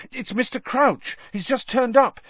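high, urgent blurting; panicked pupil explaining; urgent news. Acted. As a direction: rush the news out. Harry blurts urgent news breathlessly.

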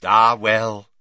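loud, jovial character voice; cheery Slughorn. A narrator's reading. Professor shrugging off a tiny class, loud and cheery. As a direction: brush it off heartily. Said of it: "said Slughorn cheerily".

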